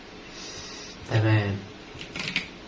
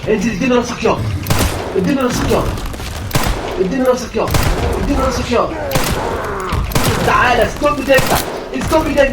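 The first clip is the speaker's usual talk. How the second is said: high, loud aim-calls; frantic combat shouting; 9 semitones higher, 5 dB louder than usual. Real, not acted. Gamer aiming at the monster's head, shouting frantically. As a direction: bark at the target while shooting. Fighting the enemy.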